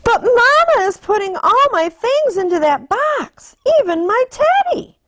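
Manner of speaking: put-on high, plaintive child voice; little sister voice. A narrator's reading. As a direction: whine about your teddy. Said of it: "said sister".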